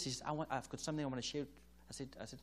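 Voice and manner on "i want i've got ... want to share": eager rush. Retold or imitated. Wife eager to share.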